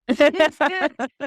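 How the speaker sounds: a loud burst of real laughter. Real, not acted. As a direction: crack up at your own joke. Laughs at her own joke.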